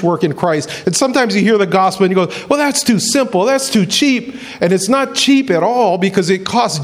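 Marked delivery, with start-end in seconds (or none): 2.5-4.3 skeptical objection. Acted. Acting a doubter hearing the gospel.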